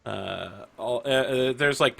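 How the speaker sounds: usual speaking voice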